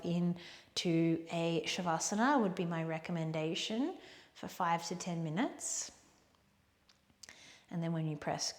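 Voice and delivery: soft, hushed yoga closing voice; quiet, unhurried instruction with long pauses; guided relaxation. Real, not acted. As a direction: guide them softly toward rest. Guides closing breaths and rest.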